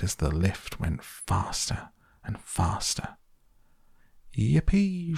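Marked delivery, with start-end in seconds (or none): 4.3-5.2 put-on high, whooping old man's cheer; gleeful shout. A narrator's reading. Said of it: "shouted Grandpa Joe again".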